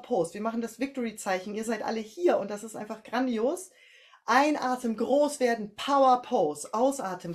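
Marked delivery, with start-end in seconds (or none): 4.3-7.3 loud, rousing coach call; energetic louder cue. Shown as a demonstration. Coach rouses the class into the power pose, loud and rousing. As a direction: pump the group up on the breath in.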